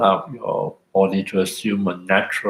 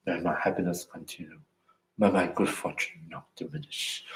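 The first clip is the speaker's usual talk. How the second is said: softer, reserved and proper, no exuberance; prim Victorian restraint; 2 semitones lower, 11 dB quieter than usual. Shown as a demonstration. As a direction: say it like a prim Victorian holding back emotion. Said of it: "my Victorian phrases".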